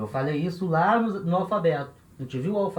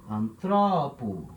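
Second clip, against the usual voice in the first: antropo drawn out slowly, stress on TRO; slow anTROpo with a long middle syllable; stress on second syllable; 3 semitones lower, much slower than usual. Shown as a demonstration. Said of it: "o acento vai cair aqui".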